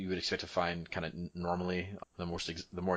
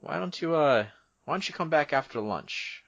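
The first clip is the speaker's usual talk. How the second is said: high-pitched, louder guard character; suggesting guard; 9 semitones higher, 6 dB louder than usual. Acted. Guard tells visitor to return after lunch, suggesting. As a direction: offer a suggestion in a raised, higher voice.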